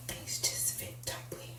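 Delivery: a quick whispered aside. Real, not acted. Telling viewers about the tight dress, whispered. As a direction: drop to a breathy secret whisper.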